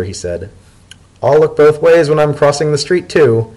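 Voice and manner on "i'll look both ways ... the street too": higher, louder teasing sing-song; mock obedient. Leafy teases Dotted for mothering him, mock obedient. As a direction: tease him like a fussing parent.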